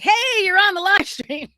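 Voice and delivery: bright loud greeting; mock cheerful call. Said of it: "I was just like".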